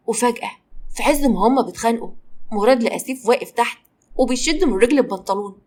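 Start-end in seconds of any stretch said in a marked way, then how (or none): none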